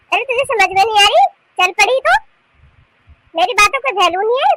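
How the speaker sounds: loud, high telling-off; indignant scolding. Acted. Mother-in-law scolding the bahu who won't answer, loud and indignant.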